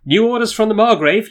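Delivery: high, loud proclamation; commanding shout. A narrator's reading. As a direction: call out orders as you approach. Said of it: "you shout as you approach".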